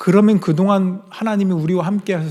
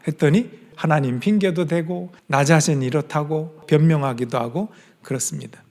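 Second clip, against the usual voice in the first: ordinary talk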